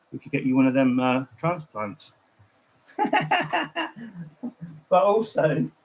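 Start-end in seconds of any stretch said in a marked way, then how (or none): 3.0-4.7 a burst of high-pitched giggling; real giggle, much higher. Real, not acted. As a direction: giggle at your own cheeky joke. Mocking his friend's hair.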